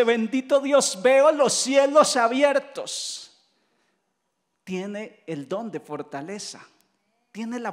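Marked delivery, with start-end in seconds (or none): 0.0-3.3 loud, high, drawn-out proclamation; exultant praise. Acted. Martyr praising God while being stoned, exultant. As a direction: cry out praise to heaven, stretching the end. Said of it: "mientras debería estar llorando, dice".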